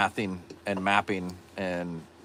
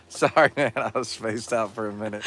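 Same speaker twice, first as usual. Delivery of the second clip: ordinary talk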